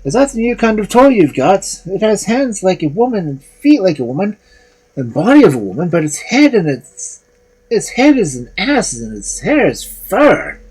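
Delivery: high, jeering voice; mocking townsfolk. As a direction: tease like a laughing crowd. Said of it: "everyone will laugh and say".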